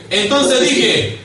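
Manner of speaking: high, loud lead-in; ringing pulpit shout; shouted reading. Shown as a demonstration. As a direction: call it out as a cue.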